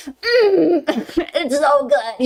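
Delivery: acted delighted moan breaking into a laugh; blissful mock swoon, laughing; food bliss. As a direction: savour it with a hammed-up "mm". Acts out savouring delicious food.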